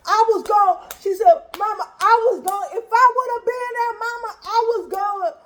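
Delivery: put-on high, hyped child's boast; fired-up kid. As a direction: brag like a little fighter. Little sister boasting she'd fight.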